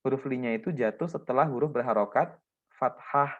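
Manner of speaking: ordinary delivery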